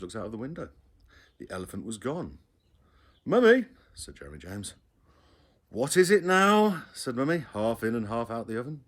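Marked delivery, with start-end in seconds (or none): 3.2-3.7 much higher, loud call; child shouting for mum. A narrator's reading. Child calling mother. 5.7-6.9 higher, louder, slow; fed up with questions; what now, exasperated; exasperated mother. A narrator's reading. Weary mother.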